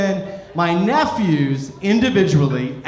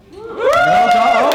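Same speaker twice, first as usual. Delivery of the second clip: squeaky high break; 6 dB louder than usual. Real, not acted. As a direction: voice jumps way up and cuts off.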